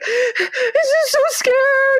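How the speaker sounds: very high, drawn-out mock wail; hammed-up little kid sobbing; imitating crying child. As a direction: wail it slowly like a tearful child. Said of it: "And he's crying".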